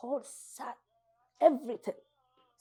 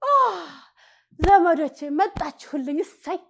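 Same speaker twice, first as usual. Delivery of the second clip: joyful greeting; 8 semitones higher, 5 dB louder than usual. Retold or imitated. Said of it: "and I go".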